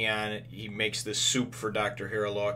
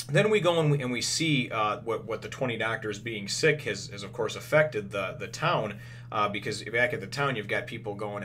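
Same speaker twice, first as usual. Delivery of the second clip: ordinary talk, nothing marked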